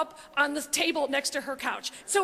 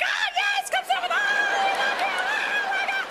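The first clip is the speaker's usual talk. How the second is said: much higher, shrieking fan laughing; ecstatic fan shriek; 4 dB louder than usual. Retold or imitated. At [name] from the seats, a giddy shriek. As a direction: scream it like a fan losing it. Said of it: "I'm like".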